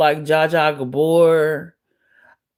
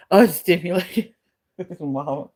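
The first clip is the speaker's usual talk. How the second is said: laughing through it. Real, not acted. Correcting her slip, laughing.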